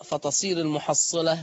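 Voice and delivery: normal talking voice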